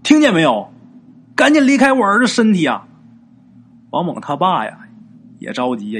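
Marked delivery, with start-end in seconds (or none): 0.0-2.9 loud, high urgent demand; raised-voice order; demanding mother. A narrator's reading. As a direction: act out a mother driving off a spirit. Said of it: "他妈呀也跟着说".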